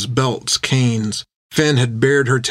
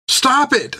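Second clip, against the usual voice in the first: very high-pitched plea; Finn pleading; 14 semitones higher than usual. A narrator's reading. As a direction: plead for the beating to end. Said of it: "she pleaded".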